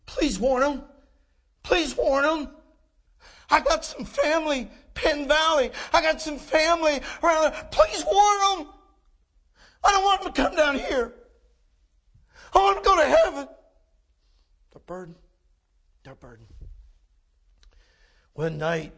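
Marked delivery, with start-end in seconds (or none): 0.0-13.5 high, anguished begging; desperate pleading. Acted. Pleading as the souls in hell. 14.7-16.5 very soft and low; hushed, grave. Acted. Hushed drop after the loud plea.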